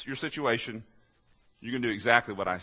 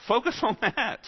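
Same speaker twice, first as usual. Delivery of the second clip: urgent preaching; 13 semitones higher, 5 dB louder than usual. Real, not acted. Preacher urging congregation.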